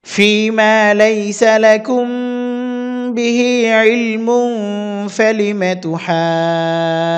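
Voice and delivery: slow melodic tajweed chant; Quran recitation. Shown as a demonstration. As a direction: recite the whole verse with long vowels. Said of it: "একসাথে সম্পূর্ণ আয়াতটি বলে দিচ্ছি".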